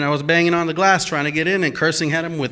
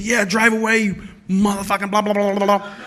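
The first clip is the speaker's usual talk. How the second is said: cursing; 6 semitones higher than usual. Retold or imitated. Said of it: "Everything, everything I can think of".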